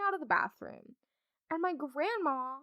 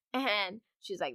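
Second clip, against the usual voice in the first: ordinary delivery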